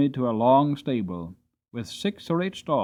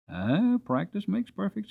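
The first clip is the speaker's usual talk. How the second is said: lightly voiced old hostler; a hint of a chirpy old man; 7 semitones higher than usual. Hostler answering James, lightly voiced old man. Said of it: "said the crooked little hostler".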